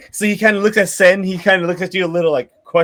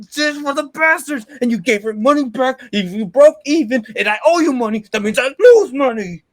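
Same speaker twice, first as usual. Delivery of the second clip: higher-pitched, indignant complaint; exasperated quest-giver; 6 semitones higher than usual. Acted. Quest-giver scolding the party over lost money, exasperated. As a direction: argue it in rising exasperation.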